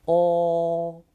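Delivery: oh held long and level; drawn-out, even oh vowel; pronunciation demo of oh. Shown as a demonstration. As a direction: sustain oh on one steady pitch. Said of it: "about the pronunciations".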